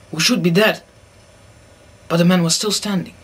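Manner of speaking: ordinary talk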